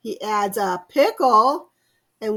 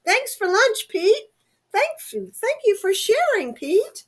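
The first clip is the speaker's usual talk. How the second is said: high, bright chorus of grateful little animals; high thankful friends; 9 semitones higher, 3 dB louder than usual. Acted. Friends thank Pete after the big lunch, high and grateful. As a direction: squeal your thanks like happy pets.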